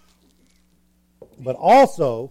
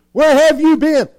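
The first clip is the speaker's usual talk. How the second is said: loud, high mock scolding; angry parent; 10 semitones higher, 7 dB louder than usual. Parent confronting a child, mock shouted demand. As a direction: raise your voice at the wayward kid.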